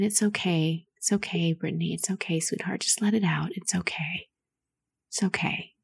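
soft, hushed comfort that fades to a whisper; calm a crying child the way a mother would; soothing inner mother. Acted. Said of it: "your inner mother, that's saying".

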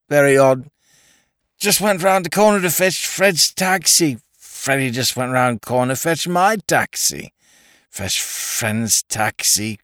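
high, slurred tipsy aristocrat; drunken toff. Acted. Drunk lord rambling to the inspector, tipsy and slurred.

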